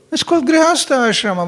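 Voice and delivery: normal talking voice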